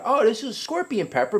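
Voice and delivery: high, eager inner voice; grower's naive excitement at a sweet pepper. Retold or imitated. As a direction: sound thrilled at the find. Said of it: "I figured".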